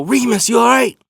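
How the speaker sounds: high worried call through the window; anxious call. Retold or imitated. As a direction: shout a worried check-in out the car window. Yelling to him from car.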